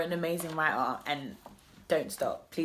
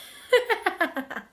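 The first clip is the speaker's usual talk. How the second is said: high giggle; 4 dB louder than usual. Real, not acted. Laughing at herself.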